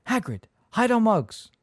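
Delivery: loud urgent call. Acted. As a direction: hurry him, panicked. Urgent hissed order under cloak.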